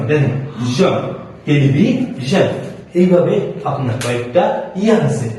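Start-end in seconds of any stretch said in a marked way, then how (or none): 1.5-2.5 টেলিভিশন slowly, split as "telibi - zhon"; broken-word demo. Shown as a demonstration. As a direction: pause before the last syllable of টেলিভিশন. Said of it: "উদাহরণটা দেখেন". (টেলিভিশন থেমে, ধীরে)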